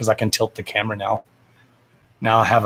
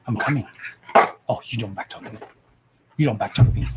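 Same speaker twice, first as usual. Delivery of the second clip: low, gruff mock scold at the dog; a hint of a stern mother scolding; 3 semitones lower, faster than usual.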